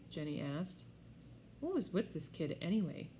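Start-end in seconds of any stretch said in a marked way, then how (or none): none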